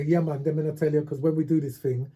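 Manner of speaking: lightly patois-flavoured London talk; patois demo. Shown as a demonstration. Showing how London youth switch, light patois. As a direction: slip into patois mid-sentence. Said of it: "so you, you're talking patois".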